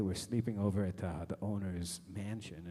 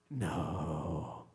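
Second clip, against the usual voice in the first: long, falling retold "no"; drawn-out disbelief; 3 semitones lower than usual. Comic reacting to the man's claim, drawn-out disbelief. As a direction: stretch it out in mock dismay.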